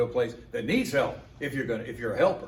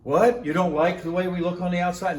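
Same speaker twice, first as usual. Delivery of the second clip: louder, higher huffy retort; offended indignation; 5 semitones higher, 6 dB louder than usual. Retold or imitated. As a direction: church member insulted by yard comment. Offended congregant voiced.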